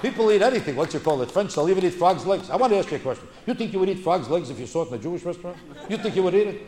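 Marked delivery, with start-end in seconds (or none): none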